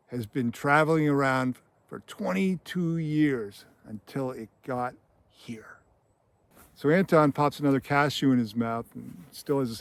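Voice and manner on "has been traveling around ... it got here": drawn-out, fading into a whisper; slow right down, ending almost voiceless; slow, ominous. Retold or imitated. Acting out Anton's ominous coin speech.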